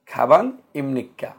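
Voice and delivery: slow খাবাং ইমনিকা, falling; ka-bang im-nik-ka carefully; Korean question. Shown as a demonstration. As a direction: model খাবাং ইমনিকা slowly. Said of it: "আর যখন প্রশ্ন করব".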